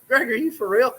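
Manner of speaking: can't believe the kindness; amazed disbelief. Retold or imitated. To Greg about the tacos, amazed disbelief. Friend brings surprise tacos.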